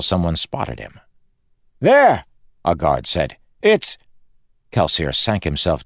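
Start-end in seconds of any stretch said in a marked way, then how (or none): 1.8-2.3 high, loud cry of discovery; guard's alarmed shout. Acted. Guard spots someone lying in the path, alarmed shout. 3.6-4.0 high, loud, cut short; startled guard. Acted. Guard starts to name what lies there, startled shout.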